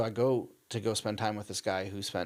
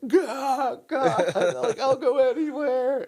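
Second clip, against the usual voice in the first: much higher, louder half-laughing cry to God; mock tearful plea; 11 dB louder, much slower than usual. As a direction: plead like sobbing in the dorm room. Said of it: "just like".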